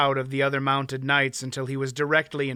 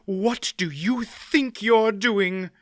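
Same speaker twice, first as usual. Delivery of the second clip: slow, raised furious scolding; raging; 6 semitones higher, slower than usual. A narrator's reading. Aunt Pole furiously scolds the smith for his risk, raging. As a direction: scold him hotly. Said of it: "Aunt Pole raged".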